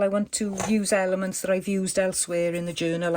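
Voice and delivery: normal talking voice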